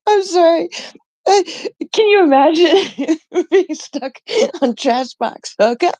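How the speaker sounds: breaking into high laughter mid-sentence; can barely get the words out for giggling; laughing. Real, not acted. Streamer to chat, cracking up over a funny name, laughing.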